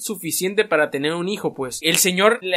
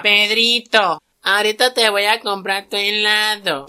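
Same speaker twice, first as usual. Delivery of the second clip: mother's voice; 3 semitones higher, slower than usual. Retold or imitated. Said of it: "Y la mamá".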